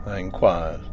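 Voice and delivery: usual speaking voice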